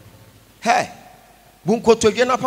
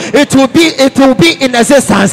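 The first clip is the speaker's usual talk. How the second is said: rousing preaching; 6 dB louder than usual. Real, not acted. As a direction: build to a forceful congregational call. Preaching builds to a shouted call.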